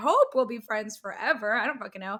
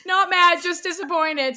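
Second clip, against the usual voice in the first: higher, louder mock scold; mock disappointed; 8 semitones higher, 9 dB louder than usual. Quoting the guy's text, mock parental scold. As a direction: deliver it like a sarcastic parent.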